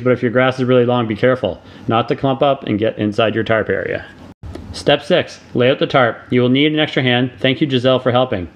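ordinary talk, nothing marked

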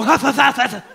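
loud acted bursts of coughing; hammed-up helpless coughing; stifled coughing fit. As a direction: cough explosively after trying to hold it in. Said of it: "ever tried not to cough".

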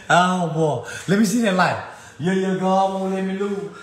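joyful rising praise; exultant. Real, not acted. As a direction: celebrate like you won.

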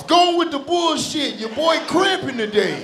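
high, loud young boy mocking; squeaky teen taunting; little boy voice. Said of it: "little boy voice".

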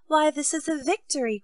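a beaming royal outburst of joy; radiant cry. A narrator's reading. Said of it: "cried the king, all radiant".

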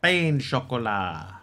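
slow mock-French, falling away; snootily elongated French pronunciation; pretentious French accent. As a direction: draw out each syllable in pretend French. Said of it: "Like, really pretentious?".

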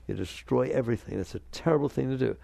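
ordinary delivery

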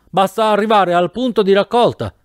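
high-pitched, contained shout. A narrator's reading. Professor on the tandem shouting back to his passenger. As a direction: call back over the wind. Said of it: "gridò il professore".

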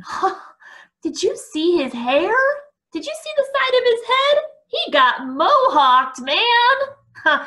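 high, loud, hooting ridicule; gleeful mockery. A narrator's reading. Eric jeering with Arthur about Mike's hair, gleeful. They were laughing.

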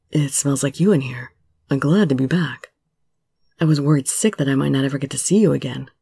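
put-on low-pitched tender young man; relieved Subaru. Voices Subaru relieved to be back.

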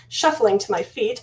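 ordinary delivery, nothing marked

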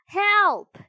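loud, high-pitched yell; shout for help. A narrator's reading. Pinocchio at cottage door fleeing murderers, shouting. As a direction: shout desperately to be let in. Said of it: "he shouted again".

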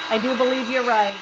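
ordinary talk, nothing marked

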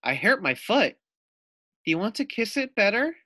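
put-on high, eager young girl; little girl voice. [name] greets the lizard in a high little girl voice. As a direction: ask brightly like a small child.

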